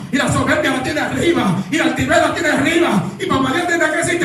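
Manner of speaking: high-pitched, driving sermon delivery; predicación enérgica. Acted. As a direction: preach it hard, pushing the pitch up. Preaches at full force. (prédica encendida)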